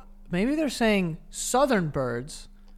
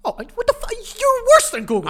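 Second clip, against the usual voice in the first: much higher, louder exasperated cry; outraged disbelief; 7 dB louder than usual. Real, not acted. Friend reacts to the Google jab with exasperated disbelief. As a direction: squeal it out in mock outrage.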